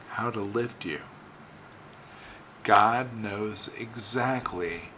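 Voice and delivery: slow, measured, with long pauses between phrases; said slowly. Real, not acted. Preacher assuring listeners God knows how to restore them, slowly. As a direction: let each phrase land before the next. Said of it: "let me say this slowly".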